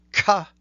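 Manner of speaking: "ka" with a hard, harsh k onset. Shown as a demonstration. Teacher demonstrates a harsh opening on "ka". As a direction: snap out "ka" with a harsh start. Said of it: "a very harsh beginning".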